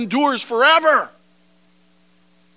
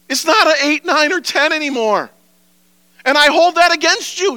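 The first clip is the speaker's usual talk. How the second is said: higher, louder voice of a hurt, disappointed Jesus; 7 semitones higher, 4 dB louder than usual. Acted. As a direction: plead as a wounded lover. Preacher voices Jesus complaining.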